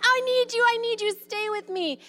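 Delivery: high whiny pleading, hammed-up; mock clingy pleading. Mom hams up clinginess to a child leaving, high pleading. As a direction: beg them to stay like a needy child.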